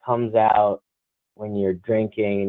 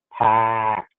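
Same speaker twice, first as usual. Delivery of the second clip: Boston "packed". Shown as a demonstration. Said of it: "The A is the one that's".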